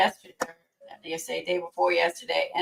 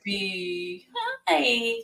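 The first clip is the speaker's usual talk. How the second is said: doggy voice; 9 semitones higher, slower than usual. Real, not acted. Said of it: "My doggy voice".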